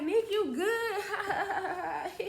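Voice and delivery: giggling through a high, slow question; laughing friend. Retold or imitated. Friend asks her woozy buddy, in a high, drawn-out giggling voice. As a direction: ask while cracking up. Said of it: "she just laughing like".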